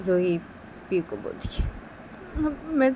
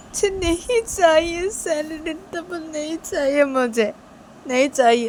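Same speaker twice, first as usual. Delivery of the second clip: pleading maid; 4 semitones higher than usual. Pretend. Maid refuses the double salary.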